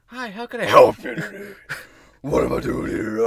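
loud put-on greeting, high then dropping; mock helper voice. Mock customer greeting turning to demon.

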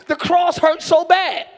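full-force preaching. Acted. Preacher to church about the nails and the cross, preaching hard. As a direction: drive each phrase home to the congregation.

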